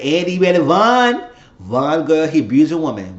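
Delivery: loud, high urgent warning to a friend; urgent warning shout. Retold or imitated. Telling her friend to run from the abuser, loud and urgent.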